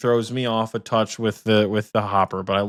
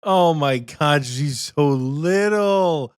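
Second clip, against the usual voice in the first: cooing; 5 semitones higher, much slower than usual. Real, not acted. Cooing over the cat.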